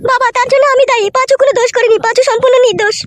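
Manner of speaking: loud, earnest pleading; loud plea. Acted. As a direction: shout the defence at father. Daughter pleads with angry father.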